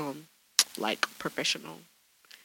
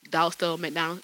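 normal talking voice

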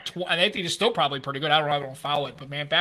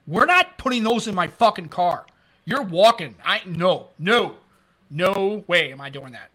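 a slower, louder mock refusal; retold firm refusal; 4 dB louder, slower than usual. A cop forbids donuts in his patrol car, firm mock refusal. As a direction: lay down the law, word by word.

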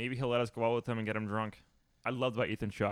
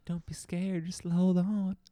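high, mock comforting voice; reassuring mock voice; 8 semitones higher, much slower than usual. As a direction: coax like talking to a scared kid. Voicing the rider reassuringly.

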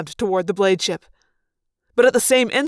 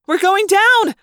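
high, loud cry of alarm; screaming that you're about to crash; panicked yell; 11 semitones higher, 6 dB louder, slower than usual. A narrator's reading. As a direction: yell it as the ship plummets. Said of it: "Rachel yelled".

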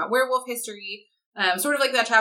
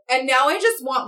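ordinary delivery

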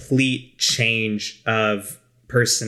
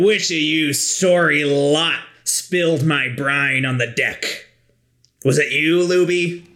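gruff pirate; 4 semitones higher, slower than usual. Acted. Pirate accusing the crew.

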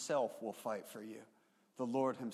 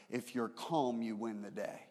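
ordinary talk

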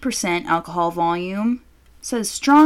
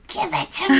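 high mock demand, laughing edge; playfully screechy demand; mock screaming; 8 semitones higher than usual. Host replaying her demand to cohost, mock screaming. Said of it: "I'm fucking screaming at you".

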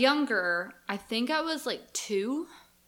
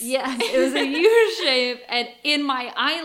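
amused, breaking into a laugh mid-line; loud laughing agreement; 4 semitones higher, 10 dB louder than usual. Real, not acted. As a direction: laugh through the words, louder. Laughing while confirming.

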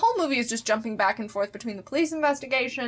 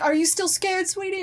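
higher, sing-song dad voice asking the question; mock sweet dad; 8 semitones higher than usual. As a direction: coo it like a doting father. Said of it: "he goes like".

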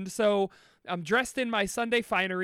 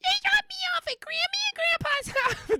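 put-on tiny whispery little kid voice, much higher; breathy kid whisper, pitched way up; six-year-old voice. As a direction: whisper it like a small child. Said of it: "What did six-year-old [name] sound like?".

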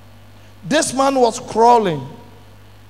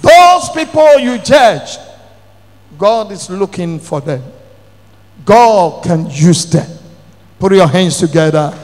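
fiery preaching; 3 semitones lower, 7 dB louder than usual. Acted. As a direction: drive the point home to the congregation. Preaching at full force.

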